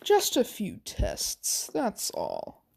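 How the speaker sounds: creepy doctor voice. Acted. Creepy scientist reassures captive.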